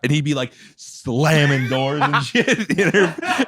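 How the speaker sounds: ordinary talk, nothing marked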